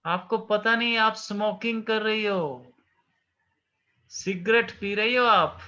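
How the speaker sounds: high, slow, stunned disbelief; astounded. Acted. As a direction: gasp it out, still astounded at her mother. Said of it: "Doris तो और भी भौचक्की रह गई".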